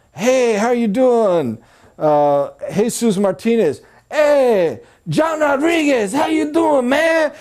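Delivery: over-the-top buddy-buddy hello; hammed-up salesman impression. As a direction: play the pushy car salesman copying every customer. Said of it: "he took their accent, and he changed his name".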